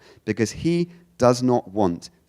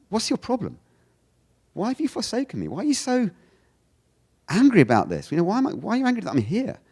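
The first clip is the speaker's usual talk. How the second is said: high-pitched, incredulous mock complaint; exasperated challenge; 12 semitones higher, faster than usual. Jesus asking God what his problem is, exasperated challenge. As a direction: challenge them in a much higher, put-on voice. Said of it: "perhaps just with a change of intonation".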